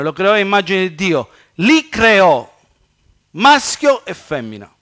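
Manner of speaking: loud, high declaimed Bible verses; preacher reading Scripture aloud, emphatically. Acted. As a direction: proclaim each verse with force, pausing between phrases. Reads scripture aloud with force.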